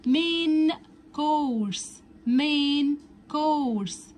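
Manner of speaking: مين كورس said slowly with a clear k sound at the start of كورس; main course with a hard k. Shown as a demonstration. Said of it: "والسي هنا بتتنطق كأنها ساوند حرف الكي". (نطق مين كورس ببطء وبصوت الكاف)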